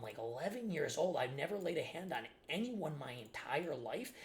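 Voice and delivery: ordinary delivery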